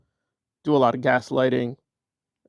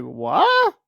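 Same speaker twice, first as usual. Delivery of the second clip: mock disbelief; 5 semitones higher than usual. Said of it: "And you're like".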